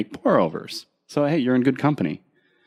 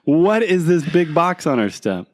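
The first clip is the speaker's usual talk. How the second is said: higher, drawn-out mock questioning; mock suspicious spouse; 7 semitones higher, 4 dB louder than usual. Co-host imitates the missus finding the box, mock questioning. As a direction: draw it out, pitched up, as pretend surprise.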